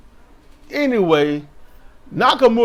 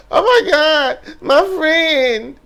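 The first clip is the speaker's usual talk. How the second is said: high, loud, hammed-up panic; mock frantic friend; 11 semitones higher, 4 dB louder, slower than usual. Mocking a wrestler running to her friend, high and frantic.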